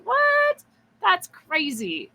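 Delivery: high-pitched squeal of retold disbelief; incredulous. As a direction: re-enact your astonishment, pitch shooting up, then drawn out. Acted disbelief at God's reveal.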